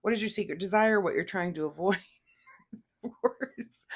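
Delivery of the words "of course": squeezed out through laughter, higher; amused, high laughing words. Real, not acted. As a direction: say it while cracking up. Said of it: "I'm not laughing at you".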